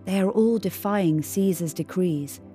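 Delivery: usual speaking voice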